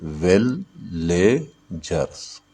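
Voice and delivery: "Villagers" very slowly as विल, ले, जर्स; syllable by syllable. Shown as a demonstration. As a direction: separate "villagers" into three drawn-out beats. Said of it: "villagers में कितने syllable है?". ("Villagers" को विल... ले... जर्स, रुक-रुक कर)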